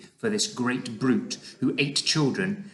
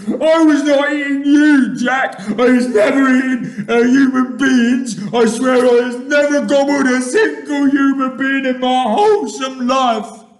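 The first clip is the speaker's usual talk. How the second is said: high, loud, panicked denial; screech; 13 semitones higher, 12 dB louder than usual. A narrator's reading. Giant pleading innocence to Jack, screeching. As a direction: wail protests at full volume. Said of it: "he screeched".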